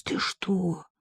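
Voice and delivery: hushed gasp of horror; horrified. A narrator's reading. Sensitive Marika picturing her employer nearby, horrified. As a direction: breathe it out aghast. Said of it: "привела чувствительную Марийку в ужас".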